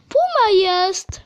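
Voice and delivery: delighted, drawn-out exclamation; thrilled to spot it; excited. Real, not acted. Spots a puma in game.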